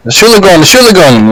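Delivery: mock yell at no real extra volume. Doctor calling at a woman in labour, mock yelling. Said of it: "the doctor starts yelling at her".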